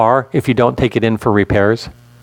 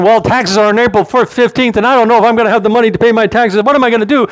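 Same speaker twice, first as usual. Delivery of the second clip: high, hurried imitation of a fretting churchgoer; mock worried; 7 semitones higher, faster than usual. Preacher mimicking a member anxious about taxes, mock worried. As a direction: rattle it off in a higher pitch, mimicking worry. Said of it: "you're all worried about that".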